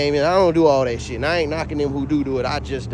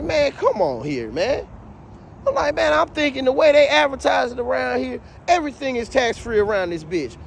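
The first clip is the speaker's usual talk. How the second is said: annoyed protest; 9 semitones higher than usual. Retold or imitated. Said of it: "I was mad as a bitch".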